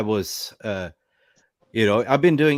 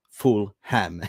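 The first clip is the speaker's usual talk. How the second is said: laughing. Real, not acted. Joke about full ham.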